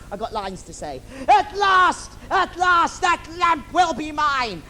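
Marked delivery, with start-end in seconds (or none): 1.3-4.6 villain triumphing over the lamp; villain gloating. Acted. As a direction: relish the victory like a panto villain.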